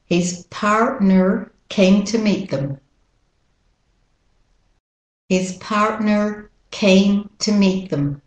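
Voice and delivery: American Rs in "partner"; American accent. Shown as a demonstration. Teacher models the sentence for copying, American accent. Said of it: "with the American accent".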